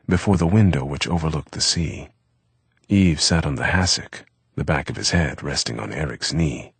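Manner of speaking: ordinary delivery, nothing marked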